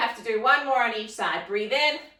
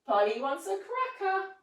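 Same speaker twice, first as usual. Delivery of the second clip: high squawky parrot squeak; 7 semitones higher than usual. Acted. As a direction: play a chattering parrot for the kids. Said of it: "we're gonna say".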